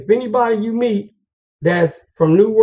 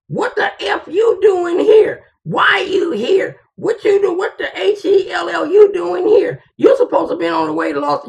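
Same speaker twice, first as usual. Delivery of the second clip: high-pitched imitation of an angry woman ranting; going off angrily; 11 semitones higher than usual. Woman going off on her man at the house, high angry imitation. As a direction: rant in a high, mock-outraged voice, stretching the first questions. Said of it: "go off on me".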